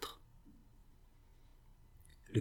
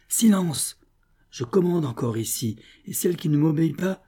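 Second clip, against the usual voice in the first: raised, high order for silence; commanding outburst; 7 semitones higher, 4 dB louder than usual. A narrator's reading. Sobieslava silences her rebellious Amazons, commanding loudly. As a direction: cut them off with forceful authority.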